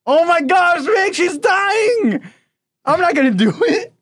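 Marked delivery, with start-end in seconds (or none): 0.0-2.2 slow, high laughing shout; shocked laughter. Real, not acted. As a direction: cry it out through laughter. Friend reacts to chili pain.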